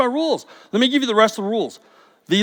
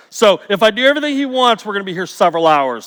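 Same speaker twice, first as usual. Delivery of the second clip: ordinary delivery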